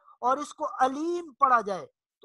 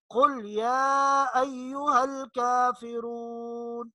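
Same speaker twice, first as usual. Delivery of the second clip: slow, melodic Quran recitation; Quranic recitation; slower than usual. Shown as a demonstration. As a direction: recite each verse slowly with a heavy qaf. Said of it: "बेशुमार मकामात पर कुरान करीम में आता है". (धीमी तिलावत, भारी क़ाफ़ के साथ)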